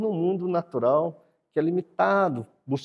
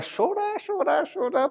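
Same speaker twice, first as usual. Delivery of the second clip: higher whiny imitation of a baby crying; mock baby crying. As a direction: mimic the baby wailing for its mother. (imitando choro de bebê, mais agudo)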